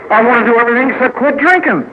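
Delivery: high, rushed whine of refusal; drinker's protest. Acted. Drinker bargaining over how to stop, high protest. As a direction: blurt it fast and high, digging in.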